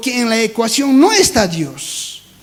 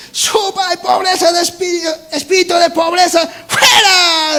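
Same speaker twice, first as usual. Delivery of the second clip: shouted rebuke; 7 semitones higher than usual. Retold or imitated. Believers casting out the spirit of poverty, shouting commands.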